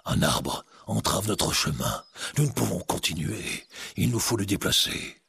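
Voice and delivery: lightly voiced heavyset soldier.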